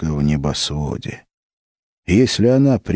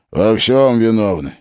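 curt; 5 semitones higher, 4 dB louder than usual. A narrator's reading. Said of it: "отрезал Бутурлин".

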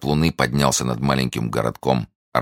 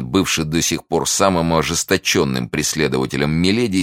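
ordinary delivery, nothing marked

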